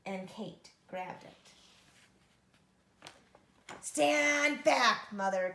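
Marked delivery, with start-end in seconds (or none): 3.8-5.5 loud warning; crying out. Acted. Kate warning mother before chopping, loud cry. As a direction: warn her off before the swing. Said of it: "Kate cried".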